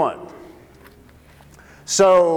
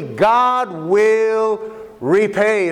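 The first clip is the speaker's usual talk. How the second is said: slow solemn declaration; much slower than usual. Acted. Preacher stating key point.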